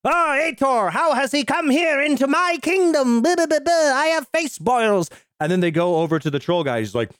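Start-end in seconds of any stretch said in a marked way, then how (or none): 0.0-5.1 much higher, hammed-up royal voice; pompous king. Podcaster mocks the film's king, hammed-up high regal voice. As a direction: lampoon the boil-faced king, grandly. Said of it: "the king is just like".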